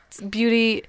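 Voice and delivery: ordinary talk, nothing marked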